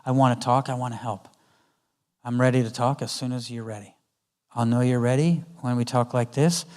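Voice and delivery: put-on quietly steady the upset child; gentle hushed reassurance; calm soft reassurance. Shown as a demonstration. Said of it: "staying in control of me and going".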